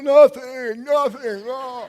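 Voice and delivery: high, loud tearful nothing, nothing; crying denial. Retold or imitated. To his wife in the cave, a high loud tearful denial. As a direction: choke out a denial through tears.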